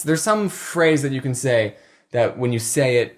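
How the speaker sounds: normal talking voice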